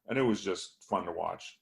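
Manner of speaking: usual speaking voice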